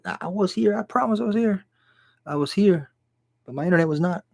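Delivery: high-pitched, put-on pleading voice; mock pleading protest. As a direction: plead your innocence in a comic high voice. Protesting that he was on time.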